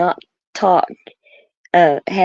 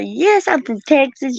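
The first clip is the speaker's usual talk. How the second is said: put-on higher-pitched, playful Texas accent; retold Texan reply, pitched up; 8 semitones higher than usual. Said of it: "all kinds of accents".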